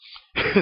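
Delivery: a quick burst of real laughter; short laugh. Real, not acted. Laughing at his own joke about God, short burst of laughter. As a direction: laugh at your own punchline.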